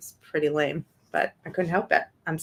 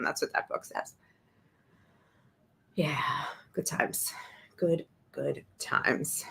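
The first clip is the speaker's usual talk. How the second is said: dry whispered sarcasm; 9 dB quieter, slower than usual. Real, not acted. Disgusted streamer whispering to her viewers. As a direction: breathe it out in disgust. Disgusted aside after reading.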